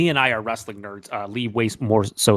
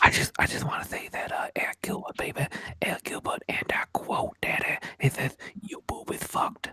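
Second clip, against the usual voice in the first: mock ASMR whisper; 5 dB quieter than usual. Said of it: "you gotta whisper".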